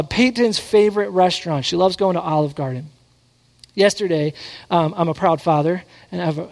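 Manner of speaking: ordinary delivery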